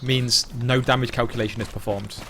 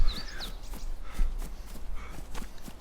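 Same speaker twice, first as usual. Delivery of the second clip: quiet breathy chuckle; soft laugh; 9 dB quieter than usual. Real, not acted. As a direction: let out a soft airy laugh. Laughing at the endless loop.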